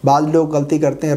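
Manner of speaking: usual speaking voice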